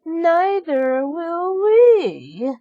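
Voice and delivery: high, slow, loud mocking sister voice; sneering stepsister. A narrator's reading. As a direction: drag it out high and snide. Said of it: "said Anastasia".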